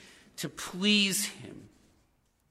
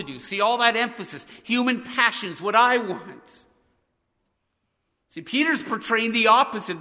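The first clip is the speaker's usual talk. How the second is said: loud, higher preaching with punched words; fervent preaching; 4 semitones higher, 7 dB louder than usual. Acted. Pastor urging his church away from selfish prayer, fervent preaching. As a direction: drive the point home to the congregation.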